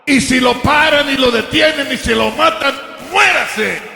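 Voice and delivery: high-pitched, defiant sermon drive; preaching at full force. Real, not acted. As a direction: hammer the repeated phrases, stretching the endings.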